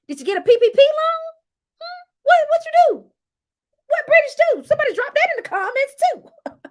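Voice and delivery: loud, high and gleeful; high teasing questions. Real, not acted. Vlogger grilling a cast member, high gleeful squeal. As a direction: press her playfully with squealed questions.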